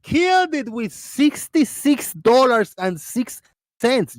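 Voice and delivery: high, slow, excited donor praise; excited, stretched-out announcement; hyped shout-out. Real, not acted. Hyping a donor's gift.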